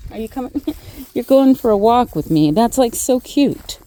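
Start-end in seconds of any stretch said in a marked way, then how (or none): none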